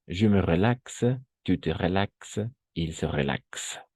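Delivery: drawn-out French forms; slow teacher demo; slow model conjugation. Shown as a demonstration. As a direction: model each form slowly. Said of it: "una cosa así".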